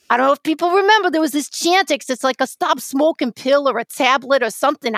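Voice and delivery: hammed-up, punchy New York accent. Host retelling a comedy clip for fun, New York accent. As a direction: tell it like a lively New Yorker. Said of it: "talk in my New York accent".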